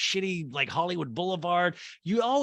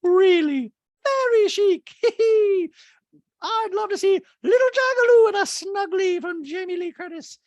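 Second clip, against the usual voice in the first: Dorit voice; 8 dB louder than usual. Retold or imitated. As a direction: gush it in a high, loud mock-posh voice. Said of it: "And Dorit goes".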